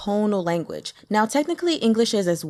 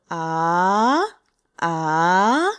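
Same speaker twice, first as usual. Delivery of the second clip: long "ah" sliding steadily upward; rising second tone; 3 semitones lower, 3 dB louder than usual. Shown as a demonstration. Teacher demonstrating Mandarin second tone, rising "ah". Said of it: "it's actually rising".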